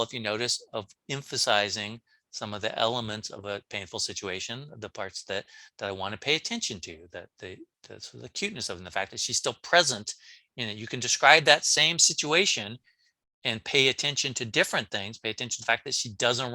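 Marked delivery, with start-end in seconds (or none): none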